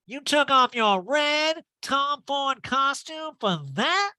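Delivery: high, loud, drawn-out mock call; hammed-up heckle from the bar; mock shout across room. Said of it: "Erica shouts across the room".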